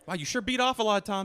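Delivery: high, needling reporter voice; mock heckling. Snooper taunting Tom after searching his trash, mock heckling. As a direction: taunt like a nosy photographer.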